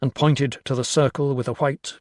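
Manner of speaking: plain reading voice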